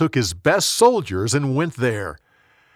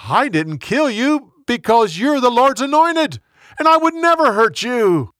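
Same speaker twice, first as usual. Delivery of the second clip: high, loud, impassioned plea from afar; calling out; 8 semitones higher, 4 dB louder than usual. A narrator's reading. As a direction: plead your innocence in a raised storyteller's voice. David calls out to Saul.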